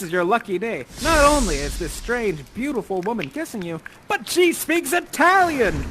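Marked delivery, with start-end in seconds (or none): 4.1-5.8 high, loud, drawn-out amazement; amazed announcement. Acted. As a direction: announce it like an astounding discovery. Builds up Ferdinand's amazement.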